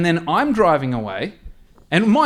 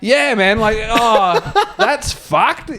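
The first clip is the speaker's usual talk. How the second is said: laughing; 7 semitones higher, much slower than usual. Retold or imitated. Telling the bystander the damage while cracking up, laughing. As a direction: laugh through it. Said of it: "I'm laughing".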